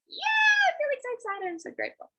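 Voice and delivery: bubbling over with gratitude; excited gushing. Shown as a demonstration. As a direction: squeal it out like you're thrilled. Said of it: "I'm just like".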